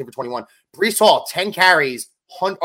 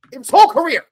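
much higher and louder on whole career; emphatic repeat; 13 semitones higher, 10 dB louder than usual. Real, not acted. Host stressing Josh Allen's worst games, loud emphatic repeat. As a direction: shout it out in disbelief.

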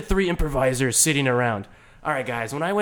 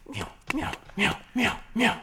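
cat meows; 6 semitones higher, 4 dB quieter than usual. Acted. Cat enters hideout.

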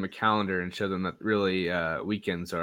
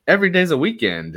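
gleeful cheer; 11 semitones higher, 10 dB louder than usual. Retold or imitated. Quoting himself, cheerful.

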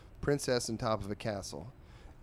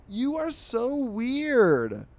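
put-on high, slow, louder drawn-out teen girl; disgusted girl; 14 semitones higher, 6 dB louder, much slower than usual. Girl tells the nerdy boy he's weird, high mocking drawl. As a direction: stretch each word like she's grossed out.

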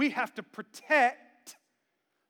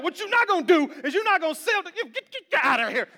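mock scolding; 7 semitones higher, 6 dB louder than usual. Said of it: "Jesus over there like".

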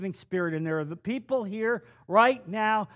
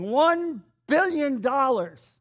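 mock absurd offer; 5 semitones higher, slower than usual. Imitating an absurd debt claim.

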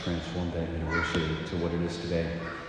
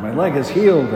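loud, higher cry of joy; excited shout; 8 semitones higher, 11 dB louder than usual. Retold or imitated. Healed student shouting to the hallway, overjoyed. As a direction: announce the healing triumphantly.

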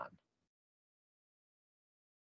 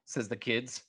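usual speaking voice

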